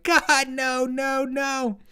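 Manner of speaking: very high, wailed mock refusal; protesting little girl. Girl refusing her mom about Biden, mock wail. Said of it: "And she's like".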